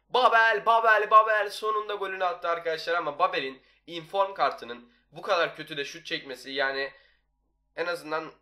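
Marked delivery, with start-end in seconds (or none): 0.1-2.9 loud excited goal yell; goal shout. Real, not acted. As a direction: yell the scorer's name.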